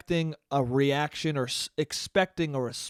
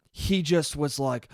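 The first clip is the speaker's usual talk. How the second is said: usual speaking voice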